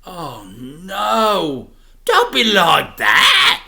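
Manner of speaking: loud, slow scold; mock scolding. A narrator's reading. Dad scolds son, loud and playful. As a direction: chide him playfully.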